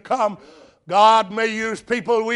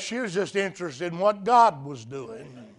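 ordinary delivery

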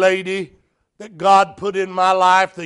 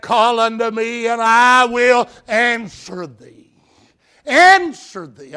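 rising preacher's call, slow and loud on the key lines; preaching at full force; 4 semitones higher, 5 dB louder than usual. Acted. Preacher proclaiming to the church, loud and building.

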